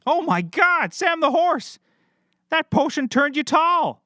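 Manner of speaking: astonished. Acted. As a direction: shout it at the sight of the tall horse. Horse grew tall.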